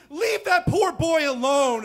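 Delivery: high, loud, slow scolding; concerned parents yelling from their cars, high and loud. Retold or imitated. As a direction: shame the bully like a shocked parent. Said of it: "They're like".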